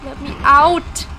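loud, slow let me out; real desperate cry; desperate shout. Real, not acted. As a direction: yell it while kicking the door. Kicking to escape cage.

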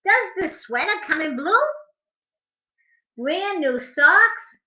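animated picture-book reading; reading to children. A narrator's reading. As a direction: read the rhymes to little kids, bouncing on the beat.

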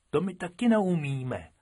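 a rabbit pleased at the fit; gleeful. Acted. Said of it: "radoval se Bobek". (radostně, výš posazeným hlasem)

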